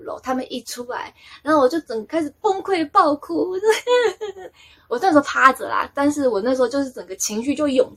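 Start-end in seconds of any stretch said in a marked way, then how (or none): 3.6-4.5 high, loud giggle; amused laugh. Real, not acted. Laughing as she recalls bursting into tears, amused. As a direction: giggle at your own story.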